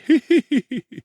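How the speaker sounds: a long high cackle; high cackling laugh. A narrator's reading. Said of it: "laughed a long time without cessation".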